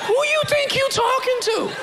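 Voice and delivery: high-pitched, affronted David; indignant outburst. Acted. David pulling rank on his father, indignant high-pitched outburst. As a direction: shoot way up in offended disbelief.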